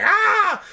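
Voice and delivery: high loud hammed-up scream; mock scream. Retelling Bruce Willis opening the orb, mock scream. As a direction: shriek it like a movie hero in agony. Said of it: "she was like".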